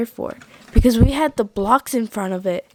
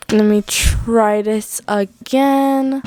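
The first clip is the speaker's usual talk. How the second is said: ordinary delivery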